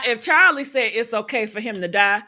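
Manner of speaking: normal talking voice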